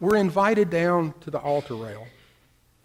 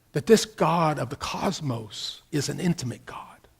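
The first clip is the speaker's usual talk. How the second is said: ordinary delivery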